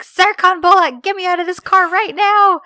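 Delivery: high, loud mock-angry outburst; angry demand. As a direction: imitate an outraged demand to be let out. Angry order to the driver, retold.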